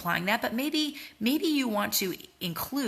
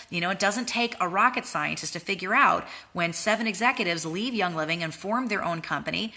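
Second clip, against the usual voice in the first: ordinary delivery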